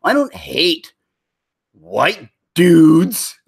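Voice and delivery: mock defensive quote. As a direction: overdo the denial so it sounds like the opposite. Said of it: "the way you said it sounds like you kinda do".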